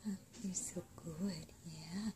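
ordinary talk

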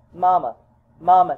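put-on child calling loudly for mamma. Child runs to find mother, calling out loud. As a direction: call out across the house.

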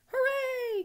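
very high, loud cheer. A narrator's reading. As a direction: whoop like a whole delighted crowd. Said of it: "cried everyone".

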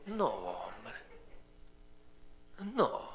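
usual speaking voice

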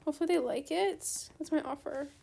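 normal talking voice

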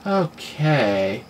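ordinary talk

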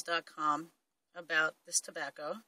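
talking around pipe stem; pipe in mouth. Real, not acted. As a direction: lips closed on pipe. Seen 0: Pipe stem held between lips throughout labelled words; removed by 5.2.

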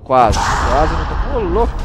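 loud, rushed almost, almost; excited near miss. Real, not acted. Gamer dodging a hit in close combat, excited shout.

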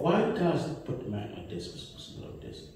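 normal talking voice

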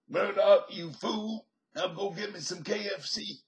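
bad impression of Murdoch barking an order. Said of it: "this is an impression of Murdoch".

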